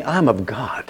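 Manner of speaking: soft unvoiced whisper. Shown as a demonstration. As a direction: breathe it out in a whisper. Leads congregation call and response.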